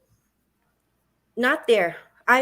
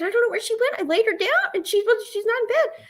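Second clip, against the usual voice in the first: retold panic, much higher; high and frantic, words spilling out; mother telling her son in high panic; totally panicked; 12 semitones higher than usual. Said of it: "Totally panicked at this point".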